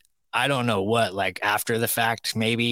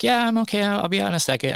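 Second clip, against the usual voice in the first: much higher mock voice of the girl; imitating the girl; 12 semitones higher, faster than usual. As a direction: play her answer in a higher pitched voice. Voicing the girl from bathroom.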